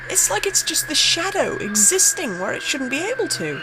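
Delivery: spooked narrator, voice tightening; rising dread. Acted. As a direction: let the fear creep up as you describe it. Describing the figure on video.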